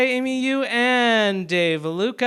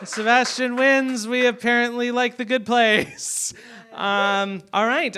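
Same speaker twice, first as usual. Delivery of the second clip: usual speaking voice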